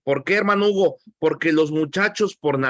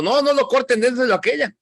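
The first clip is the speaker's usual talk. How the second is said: higher, pleading mother's cry; pleading true mother; 7 semitones higher, faster than usual. Retold or imitated. The true mother begs Solomon to spare her baby, higher and pleading. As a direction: beg them to spare the baby. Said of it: "la verdadera mamá dijo".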